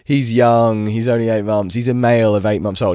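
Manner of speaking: lightly mocking a careless dog owner; mimicking the owner. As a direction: parody her breezy excuses. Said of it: "she starts going like".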